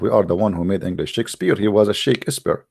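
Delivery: ordinary delivery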